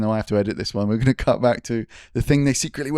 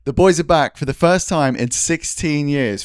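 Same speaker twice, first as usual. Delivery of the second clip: podcast intro; 5 semitones higher, 6 dB louder than usual. Acted.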